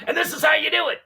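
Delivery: high, loud showman announcement; showman shout. Shown as a demonstration. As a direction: belt it out like a performer on a table. Jumping on table, entertaining class.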